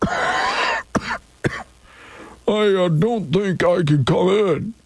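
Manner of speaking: weak sickly voice with a cough. Pretend. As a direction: sound sick calling in to work. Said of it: "Here's my sick voice".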